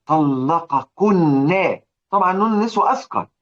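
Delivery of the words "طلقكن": طلقكن stretched very slowly so its heavy nun of women sounds weighty; heavy drawn-out word. Shown as a demonstration. Tajweed teacher showing students the heavy طلقكن, very slowly drawn out. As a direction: drag طلقكن out slowly to show its heaviness. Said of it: "نون النسوة الثقيلة".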